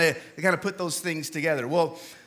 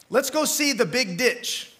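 quoting Arizona locals; 5 semitones higher, slower than usual. Retold or imitated. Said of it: "people in Arizona say things like".

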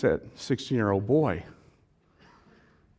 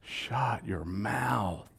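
softer, lower, slow groan; muttered groan; 6 semitones lower, 10 dB quieter, much slower than usual. Retold or imitated. Disciples dreading Peter's boast, soft and low. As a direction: groan it under your breath. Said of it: "some guys in there going".

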